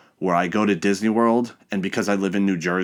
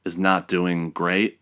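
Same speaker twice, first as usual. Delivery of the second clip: ordinary talk, nothing marked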